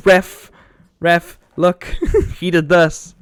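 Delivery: mock-whine for the referee, slowing on the last line; whiny call to the ref. Calling the ref over a foul.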